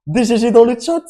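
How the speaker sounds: high, loud hype shout; excited. Real, not acted. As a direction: hype it up loudly. Celebrating the eruption.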